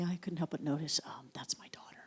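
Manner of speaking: hushed, secretive whisper. Retold or imitated. Mom confiding to a stranger in the bookstore line, whispering. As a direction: lean in and whisper it.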